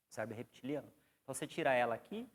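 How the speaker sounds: usual speaking voice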